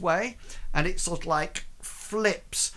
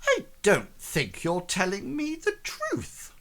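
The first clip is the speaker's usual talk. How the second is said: higher-pitched merchant accusation, rising at the end; distrustful merchant; 5 semitones higher than usual. Acted. Merchant rounding on the crew member, higher and distrustful. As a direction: challenge the stranger as the doubting merchant. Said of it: "rounds to you and he says".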